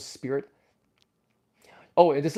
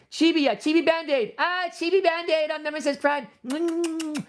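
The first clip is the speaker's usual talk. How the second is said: cutesy gushing; 12 semitones higher than usual. Real, not acted. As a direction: coo over a tiny toy. Gushing over toy.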